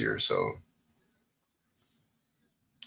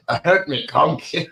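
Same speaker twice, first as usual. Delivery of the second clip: put-on Scottish accent; 8 semitones higher, slower than usual. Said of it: "our poor Scottish accents".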